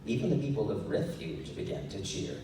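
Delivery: usual speaking voice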